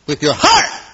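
high, loud climactic preaching; preaching at full force. Real, not acted. Preacher building the climax to the congregation, high and loud. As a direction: ring it out to the back pew.

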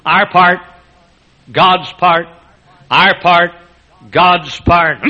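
each part punched loud; sermon outline. Shown as a demonstration. Preacher recalling his sermon, punching each point loud. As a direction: hit our and God's like points on a list. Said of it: "the sermon I preached".

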